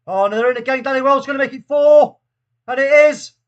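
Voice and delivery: loud, rising disbelief as the goal goes in; exasperated loud commentary. Real, not acted. Streamer watching his team concede, exasperated loud call. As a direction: call the goal through gritted frustration.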